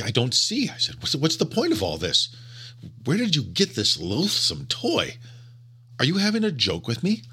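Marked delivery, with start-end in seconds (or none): 0.0-0.7 higher, louder, sharp and rattled. A narrator's reading. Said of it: "I spoke sharply". 1.0-7.2 spoke sharply. A narrator's reading. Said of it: "I spoke sharply".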